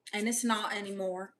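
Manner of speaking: ordinary delivery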